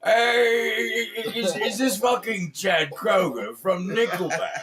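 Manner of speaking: loud, high British rock-star impression. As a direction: stammer and holler like a thrilled old rocker. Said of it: "Act like [name]".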